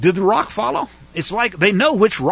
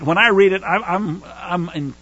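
ordinary delivery

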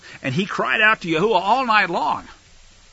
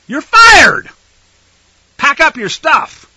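mock shout; 8 semitones higher, 12 dB louder, slower than usual. Samuel dismissing Saul, mock.

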